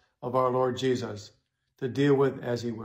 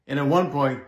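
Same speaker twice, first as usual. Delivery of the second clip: ordinary talk, nothing marked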